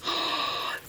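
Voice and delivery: big, breathy gasp of joy; delighted gasp. Real, not acted. Delighted by the gloss.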